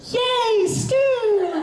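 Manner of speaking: put-on high, squealed childlike cheer; long, gleeful high-pitched cry. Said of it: "Al turns around and goes".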